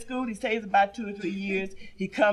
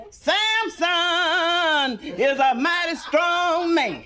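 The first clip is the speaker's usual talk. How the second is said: preacher voice; 6 semitones higher, much slower than usual. Acted. Preacher singing out to the congregation. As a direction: stretch it like a Sunday preacher. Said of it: "He preaching about Samson".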